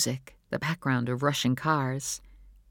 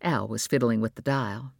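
ordinary delivery

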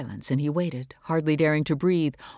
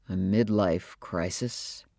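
low, slow, musing echo of her words; thoughtful echo; 8 semitones lower, 4 dB quieter, slower than usual. Acted. Father weighing his wife's accusation, slow and thoughtful. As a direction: turn the phrase over slowly, considering it. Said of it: "thoughtful, as if he were considering".

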